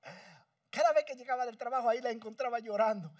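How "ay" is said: higher, soft mock wail; imitating crying mother. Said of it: "vivía llorando". (imitando el llanto de la madre, agudo y suave)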